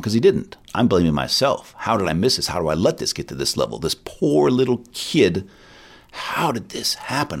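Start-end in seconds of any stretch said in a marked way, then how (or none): 3.8-7.4 slow, choked-up real sorrow; tearing up. Real, not acted. Father telling a counselor's story about his son, slow and close to tears. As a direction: let the words come slowly as you fight tears. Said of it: "'cause I'm tearing up".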